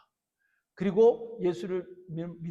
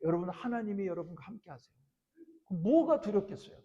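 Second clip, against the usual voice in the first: ordinary talk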